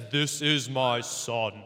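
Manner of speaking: slow, booming Darth Vader-like voice; God. Acted. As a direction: do a hammed-up almighty voice, drawn out. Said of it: "That's my God impression".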